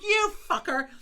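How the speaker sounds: high, loud mock curse at the chicken; retold outburst at the chicken. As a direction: snap it at the stuck chicken like it's a person. Chicken sticks to hot oven door.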